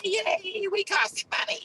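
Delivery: high, giddy mocking voice; mock happy voice. Mocking his own code, a high giddy voice. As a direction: mimic a cheerful dumb program. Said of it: "I know you're happy".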